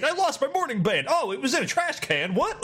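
high, silly NPC voice; goofy game NPC. Retold or imitated. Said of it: "Just like".

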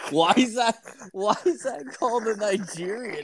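high, laughing question; asking while cracking up. Real, not acted. Friend asks about the name, laughing.

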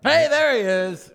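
slow, drawn-out hype greeting; put-on greeting voice. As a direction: stretch it out like a hype man.

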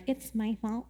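guilty confession. Retold or imitated. Jonah confessing to the sailors, soft mild guilt. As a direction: quietly own up as Jonah. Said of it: "he finally is like".

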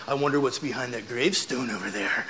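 low, soft creepy hint; spooky tease. Retold or imitated. Big brother spooking little sisters in the cemetery, low and soft.

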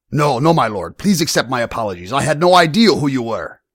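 high, loud, hurried plea; suddenly compliant, pleading apology. A narrator's reading. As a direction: beg the lord for forgiveness. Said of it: "Vargas pleaded".

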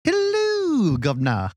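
very high, sing-song Hello, then plain governor; co-host hamming up a hello to the listeners; mock posh greeting. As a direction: squeak out Hello like a cartoon gent. Jokey greeting to audience.

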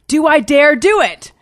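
high, loud theatrical self-question; mock-dramatic dare. Patient eyes a shortcut to her therapist, mock-dramatic daring. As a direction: pitch it up loud as a grand dilemma.

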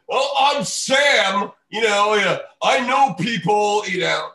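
hammed-up mock of Sam bragging. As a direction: sneer it out like a self-important host. Mocking the radio host.

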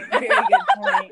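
high and loud through laughter; laughing. Real, not acted. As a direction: crack up on the repeat. Repeats remark, then laughs.

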